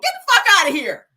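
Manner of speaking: loud, high brush-off; mock brazen dismissal; telling off the police. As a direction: bark it dismissively. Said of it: "That's how you talk to the police".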